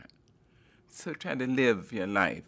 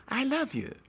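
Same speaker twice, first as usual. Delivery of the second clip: I love you lifted much higher; high tender I love you; 11 semitones higher than usual. Shown as a demonstration. Love speaks, repeated declaration.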